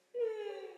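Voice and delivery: high, soft sobbing hum; a high, soft crying hum; sob. Shown as a demonstration. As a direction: sob through Dido's Lament. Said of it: "a little bit of a sob".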